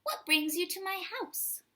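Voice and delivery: high, bright-eyed question; exclaimed. Acted. Amu greeting a surprise visitor, exclaiming high. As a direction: exclaim it in delight. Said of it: "Amu exclaimed".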